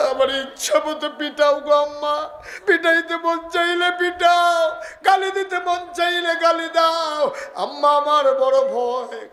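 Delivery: acted high, wailing entreaty; weeping plea. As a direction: plead at full pitch as a weeping caliph. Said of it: "Umar কান্দে আর কয়".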